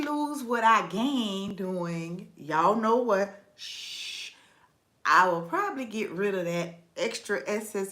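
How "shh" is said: soft, long hushing hiss; quiet conspiratorial shush; drawn-out shush. Real, not acted. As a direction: shush instead of saying it. Hushing instead of naming it.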